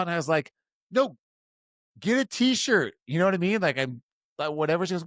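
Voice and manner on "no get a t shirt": high, emphatic mock order; acted insistence. Teenage boy pushing a gift on his date, acted insistence. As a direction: cut off her protest insistently.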